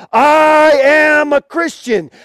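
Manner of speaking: long drawn-out, high shout; acted martyr's defiant proclamation; bold declaration. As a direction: cry it out to a whole arena, stretching the words. Repeats the martyr's cry.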